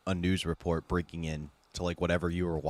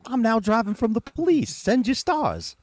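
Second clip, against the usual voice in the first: high, loud put-on voice for a hammed-up O J; high hammed-up O J tweeting from the chase; mock O J voice; 6 dB louder than usual. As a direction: pitch way up and announce it like a cheery influencer. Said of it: "where it's O J".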